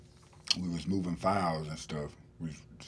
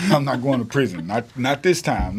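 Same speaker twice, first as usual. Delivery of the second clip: loud, higher, boastful; cocky defiance; 6 semitones higher, 10 dB louder than usual. Retold or imitated. Young inmate boasting to the sergeant, loud and cocky. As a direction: crow it like you beat the system.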